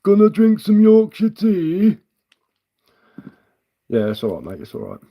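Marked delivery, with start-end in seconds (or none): 0.0-2.0 high, loud, drawn-out mock Yorkshire; badly faked Yorkshire accent, stretched out slowly; poor Yorkshire accent. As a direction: belt out a hammy Yorkshire impression, pitched way up. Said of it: "my poor attempt at a Yorkshire accent".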